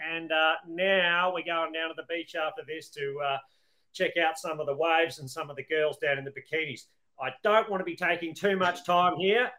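hammed-up Aussie bloke accent, laid on thick; thick Australian accent. [name] jokes to American hosts in a hammed-up Australian accent. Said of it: "Were you putting on the a-, accent extra thick?".

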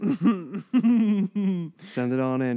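high, loud, laughing through it. Real, not acted. Cohost laughs while echoing the host's sign-off.

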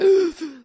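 loud, high re-enacted gasp; gasp for air. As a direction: imitate sucking in air after choking. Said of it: "like, gasp for air".

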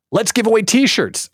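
high-pitched mock enthusiasm; eager pitch. Staffer offering a promotion idea in a meeting, eager. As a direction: blurt it out like a thrilled junior staffer. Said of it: "let's go into the decision-making room".

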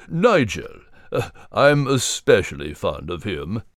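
put-on low, gentle old storyteller; old Gilliman voice. Gilliman greets the little ones, low and gentle.

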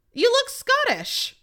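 high, slow, loud teasing dad; mock dad voice. Dad teasing daughter on Thanksgiving, high and slow. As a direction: draw out the dad's teasing jab. Dad's remark acted out.

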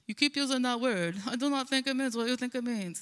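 Inigo Montoya. Acted. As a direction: quote it in a hammed-up, terrible Spanish accent. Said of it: "I have a terrible accent".

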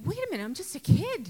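high, fast, startled blurt; startled realisation. Retold or imitated. Young self realising he must grow up, startled. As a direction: blurt it out like a kid suddenly caught off guard.

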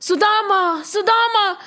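high, stretched-out distant-friend call; shouting. Acted. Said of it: "heard somebody shouting".

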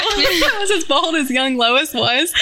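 said through real laughter, slowed; amused, laughing admiration. Real, not acted. Laughing at her younger self.

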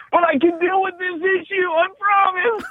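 very high squeaky falsetto, played for laughs; mock pleading. Pastor jokingly begging to pass the test, high mock plea. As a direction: plead in a comically high put-on voice.

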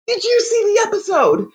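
high, eager question; bursting to share the news; excited asking friends about the episode. Retold or imitated.

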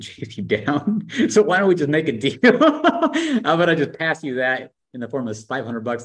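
high, loud, laughing through the words. Real, not acted. Host retelling the guest's sales pitch, cracking up.

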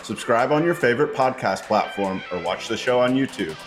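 announcer-style show intro; podcast intro read. Acted. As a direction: read it like a polished promo. Podcast intro over music.

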